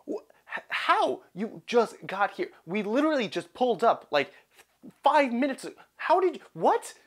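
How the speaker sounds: stammering, high-pitched imitation of a flabbergasted mother; mock disbelief. Mother reacting to child's car crash news, flabbergasted and spluttering. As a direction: act out stunned spluttering, jumping up high and loud.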